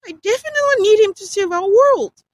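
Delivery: high, loud urgent cut-in; interrupting. A narrator's reading. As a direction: cut in desperately. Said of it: "she interrupted".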